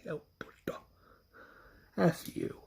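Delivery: hissed insult under the breath; soft spiteful whisper over the corpse; contemptuous whisper. Retold or imitated. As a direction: whisper it with disgust. Spitting on the walker.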